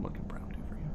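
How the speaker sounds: soft whispered slogan; whispered tagline. Retold or imitated. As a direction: whisper the ad line. Tagline trailing off.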